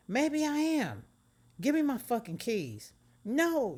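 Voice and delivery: very high, loud yelling; shouting match. Acted. Cheetah and Megan yelling over the keys. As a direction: scream it at her.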